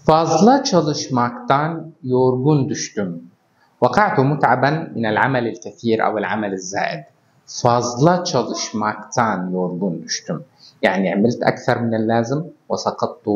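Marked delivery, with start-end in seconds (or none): 0.1-3.3 every word drawn out slowly for the class; slow example sentence. Shown as a demonstration. Teacher modelling a Turkish example for learners, slowly. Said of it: "مثال آخر". 7.5-10.4 every word drawn out slowly for the class; slow example sentence. Shown as a demonstration. Teacher modelling a Turkish example for learners, slowly. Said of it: "مثال آخر".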